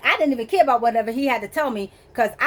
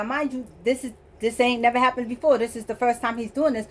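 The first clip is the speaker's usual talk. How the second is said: usual speaking voice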